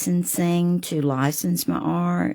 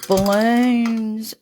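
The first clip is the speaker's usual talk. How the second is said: gasp, then a high, bright Balloons; thrilled at a new idea; delighted gasp; 8 semitones higher than usual. Real, not acted. Painter gasps at her own balloon idea, delighted.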